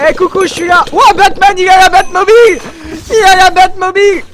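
high, loud excited calls; excited shouting. Real, not acted. Player spotting Batman in the Batmobile, excited shouting. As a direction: shout it out, pitch right up.

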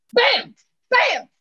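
two loud, sudden shot noises; imitated gunshots. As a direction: fire off the rifle with your voice. Imitates the rifle shots.

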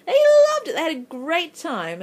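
high, loud burst of delight; gleeful emphasis. Real, not acted. Vlogger telling how much the girls loved the game, gleeful. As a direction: stretch it out in a loud, high squeal.

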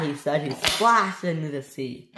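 usual speaking voice

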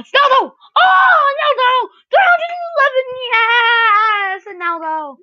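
high, loud ecstatic shout easing off at the end; excited screaming. Real, not acted. Gamer celebrating a huge Ronaldo pull, excited screaming. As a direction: scream it out in disbelief and joy. Big pack pull while opening.

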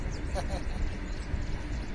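soft, breath-only mock chuckle; scoffing laugh. Acting out the poem's scoffer, mocking laugh. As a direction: let out a long scoffing chuckle.

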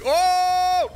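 a loud, high yelped Oh; startled shout. Real, not acted. Gamer yelling when an enemy fires at him. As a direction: cry out at the sudden attack.

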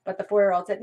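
ordinary talk, nothing marked

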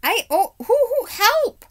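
loud, very high horsey hooting into a cry for help; panicked yelp. Acted. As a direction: shriek in sudden terror. Acted startled horse crying for help.

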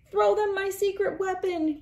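high urgent shout; crying out. A narrator's reading. Ralph cries out to the crew for his weapon, high urgent shout. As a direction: yell it out desperately. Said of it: "cried Ralph".

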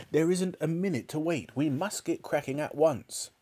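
high, urgent insistence; mild shout. A narrator's reading. Father presses his son to start at once, urgently. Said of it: "shouted Mister Cranky".

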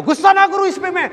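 father raging at his son while beating him, loud high shout; furious father. Retold or imitated. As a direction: roar at the boy while hitting him.

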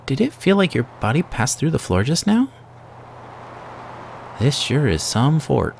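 excited character voice. Acted. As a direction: read the game hero's awe up high. Reading character dialogue aloud.

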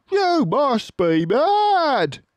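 very high, slow mocking shout; jeering villager. A narrator's reading. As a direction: laugh at the farmers. Crowd jeers.

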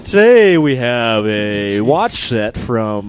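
slow, drawn-out prize announcer; a light Price is Right announcer voice; game show announcer. Retold or imitated. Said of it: "the Price is Right guy who talks over".

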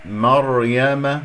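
مريم chanted slowly as mar-ya-ma; correct heavy ra. Shown as a demonstration. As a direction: recite مريم slowly, r thick and unvowelled. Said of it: "بطريقتها التي سمعتموها".

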